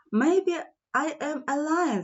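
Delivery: hopeful little mouse wondering aloud. Acted.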